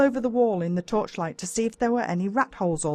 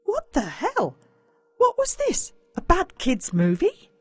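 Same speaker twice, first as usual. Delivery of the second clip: incredulous; 11 semitones higher than usual. A narrator's reading. Narrator's incredulous asides.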